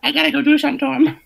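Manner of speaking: high, fast, lightly voiced wife; a quick, high mock of his wife; excited wife. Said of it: "and she was like".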